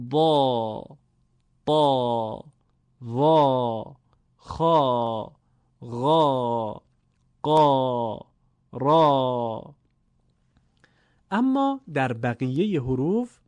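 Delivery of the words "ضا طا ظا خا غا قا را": «ضا» through «را» each drawn out thick and full; slow emphatic Arabic syllables, «ضا» to «را»; heavy long alef. Shown as a demonstration. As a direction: hold «ضا، طا، ظا» long with a dark vowel. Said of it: "اگر الف مدی هم داشته باشن".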